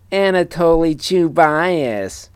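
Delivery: high, slow, drawn-out nasal whine; whiny nasal voice. Acted. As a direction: stretch the name in a whiny nasal tone. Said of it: "a weird, whiny, nasally voice".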